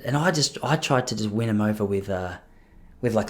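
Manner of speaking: usual speaking voice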